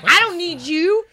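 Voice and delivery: high, slow mock dismissal; mock defiance. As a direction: draw it out high. Acts out song's defiant message.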